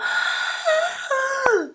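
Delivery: noisy, high-pitched inhale through a tight throat; obstructed gasp. Shown as a demonstration. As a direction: gasp in as if the airway is blocked. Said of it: "it's very obstructed, it's very blocked".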